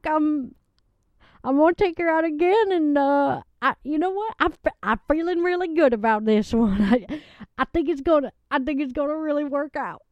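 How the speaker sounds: a sweet country woman gushing about her date; a lovelorn Southern guest giggling through her story; Southern lady Loretta. Acted.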